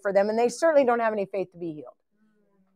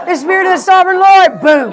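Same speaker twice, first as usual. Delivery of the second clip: loud, higher booming declaration; mock preacher shout; 8 semitones higher, 13 dB louder than usual. Imitating a preacher at full force on the altar. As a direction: belt it like a fired-up preacher.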